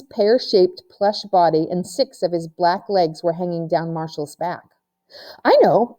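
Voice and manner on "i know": louder, higher burst of an idea; sudden idea. A narrator's reading. April suddenly gets a game idea, excited burst. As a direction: blurt it as the idea hits. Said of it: "April said suddenly".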